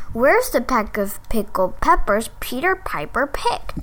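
slow, even tongue twister. Shown as a demonstration. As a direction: take it slow and clear. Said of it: "Okay, nice and slow".

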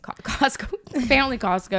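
laughing through the word Costco; amused disbelief, laughing it out. Real, not acted. Laughing at mom's Costco love.

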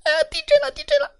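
very high squeaky panic cries; 老鼠们惊慌大叫; panicked mice. A narrator's reading. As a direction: squeal like mice fleeing a quake. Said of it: "抱头乱跑".

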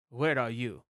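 a hint of mock English accent; imitated English accent. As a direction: mimic an actress slipping into English accent. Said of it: "went into an English accent".

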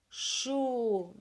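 "Chuvisco" slow, as chu, vis, co; syllable by syllable. Shown as a demonstration. Teacher having pupils count syllables, slowly separating them. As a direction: stretch each syllable and pause between. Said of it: "Separa as sílabas".

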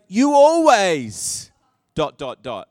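loud, drawn-out "always" in a mock quarrel; accusing spouse. Arguing with a spouse, loud accusing "always". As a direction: hurl the accusation. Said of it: "we say".

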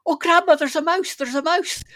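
high, loud imitated cry of alarm; excited child's cry. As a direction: call out like a startled child seeing a mouse. Child spots mouse, retold.

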